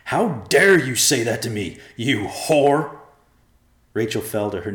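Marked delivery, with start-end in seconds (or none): 0.0-3.0 loud, raised outrage; venomous anger. A narrator's reading. Husband turns on his wife, venomous. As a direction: lash out with venom. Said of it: "turned on his wife with venom".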